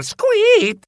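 very high mouse squeal; high-pitched cartoon yelp; pained squeak. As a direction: squeal sharply in pain. Mouse squeals during rough massage.

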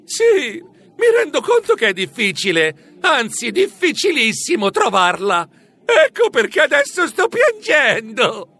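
high voice, crying through the words. Acted. The lost mouse cries to the professor, tearful. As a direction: weep while you talk. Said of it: "Io mi misi a piangere".

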